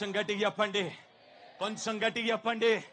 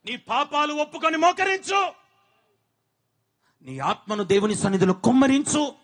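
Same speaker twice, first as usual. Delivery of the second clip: loud, higher commanding call; preaching shout; 3 semitones higher, 9 dB louder than usual. Acted. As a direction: order them down to their knees. Commanding congregation to kneel. (బిగ్గరగా ఆజ్ఞాపించే అరుపు)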